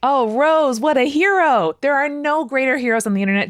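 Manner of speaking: high, loud, excited acclaim; delighted high-pitched cheering on; gushing praise. Real, not acted. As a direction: hail a hero with big enthusiasm. Gushing praise for listener's update.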